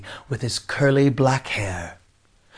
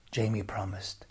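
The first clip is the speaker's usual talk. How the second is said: ordinary delivery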